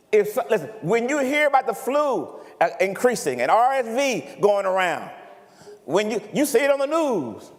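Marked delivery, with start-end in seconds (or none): none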